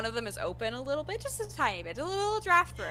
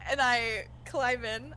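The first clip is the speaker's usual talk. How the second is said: ordinary delivery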